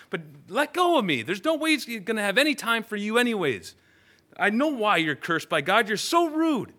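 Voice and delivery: high, loud irritated retort; annoyed brush-off. Acted. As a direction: shake him off, fed up. Annoyed man shakes off beggar.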